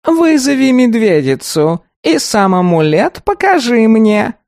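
high, slow, creaky golem demanding. A narrator's reading. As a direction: stiff wooden creature, insistent.

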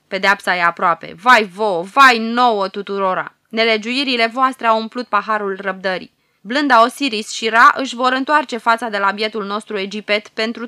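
loud, wailing cries of woe; wailing lament. Acted. Old prophet wails woe upon the court, loud and grieving. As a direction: cry out the doom, near tears. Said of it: "într-un hohot de plâns vorbele".

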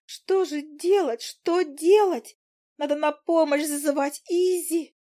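high, slow then rising tiny voice in a flap; a little fly running about in panic; panicking fly. Acted. The fly panicking on Izi's shoulder, high and frantic. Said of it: "Мушка забегала по моему плечу".

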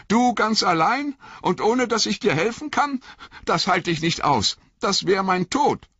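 high, hurt, shivering reproach; a wet hobbit stung by his master; Sam, cold and soaked, reproaching Frodo in a high voice. Acted.

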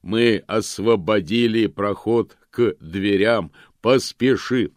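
slow, loud golem voice; booming golem. A narrator's reading. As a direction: urge him on, booming. Golem urges him out. (громкий медленный голос голема)

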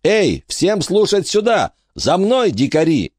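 put-on boy calls the children to follow him, cocky; calling out. A narrator's reading. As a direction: lead the pack with bravado.

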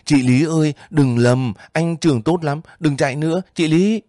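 chasing her and shouting; shouting after her. A narrator's reading. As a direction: yell to stop her running. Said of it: "Vũ chạy theo gào".

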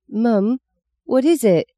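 put-on timid girl asking her mother; frightened little girl. A narrator's reading. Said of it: "Maggie finally managed to say".

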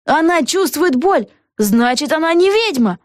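high-pitched accusation from the crowd; crying out. A narrator's reading. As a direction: call out the verdict in a high voice. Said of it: "закричал кто-то".

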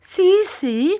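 high, loud cry; sharp high plaintive cry. A narrator's reading. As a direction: wail it out. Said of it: "s'écria mistress Gummidge".

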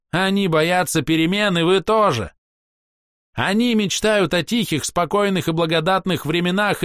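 high, proud ringing voice; a scornful elf lecturing strangers; haughty elf. A narrator's reading. Elf Castiel's tirade.

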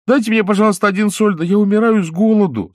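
put-on high, pleading puppet boy. A narrator's reading. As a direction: beg like a hungry boy. Pinocchio begging.

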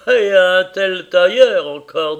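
slow, loud boasting; proud boast. Acted. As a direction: savour each word with pride. Caleb boasting of tailor.

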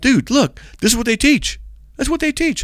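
high-pitched insistence; retold arguing back. Preacher insisting to a driver, retold arguing back.

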